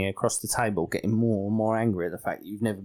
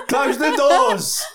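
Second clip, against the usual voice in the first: very slow, high, loud holler, laughing; mock alarmed shout; 12 semitones higher, 5 dB louder, much slower than usual. Householder orders doors shut on intruder, mock alarmed shout. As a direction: stretch it into a hammed-up cry.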